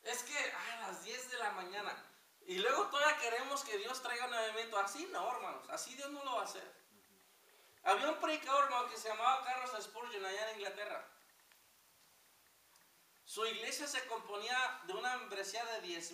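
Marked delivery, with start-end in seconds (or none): 2.5-11.1 loud, driving pulpit voice; preaching at full force. Acted. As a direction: hammer the warning home to the congregation. Prediger ermahnt die Gemeinde.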